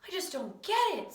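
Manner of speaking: high-pitched, frustrated pupil; frustrated complaint. Acted. Struggling pupil tells teacher counting baffles him, frustrated.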